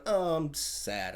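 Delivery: slow, soft, hammed-up sad; mock sadness. Harold losing the lady, slow mock sadness. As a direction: droop it out like a sulky hero. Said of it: "Harold's like".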